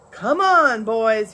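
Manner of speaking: Big Jerry calling the men to ride with him; shouted. A narrator's reading. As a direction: whoop the men into following. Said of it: "he shouted".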